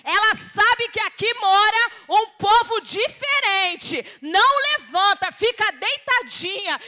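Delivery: loud, slow, commanding divine voice in her ear; voice of the Lord. Retold or imitated. God telling her in her ear to stay lying down, loud and slow. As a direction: preach it out slowly as God speaking. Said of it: "o Senhor falou ao meu ouvido".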